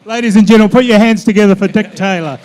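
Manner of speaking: rally the room to clap; big loud send-off to applause; announcer's applause call. Real, not acted. Host calls for applause.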